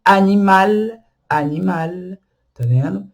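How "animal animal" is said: "animal" with open A and clear final L; open French A. Shown as a demonstration. Teacher giving examples of open A, French pronunciation demo. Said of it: "a gente pronuncia ele aberto".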